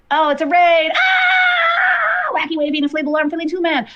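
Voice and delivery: sudden yelp at the raid, voice jumping high; startled shout. Real, not acted. Streamer startled by a raid, shouting excitedly. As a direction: shriek in surprise then laugh it off.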